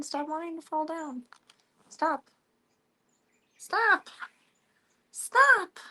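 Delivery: rising high mock-scold at a gadget; squeaky pleading at the laptop; playful scolding. Real, not acted.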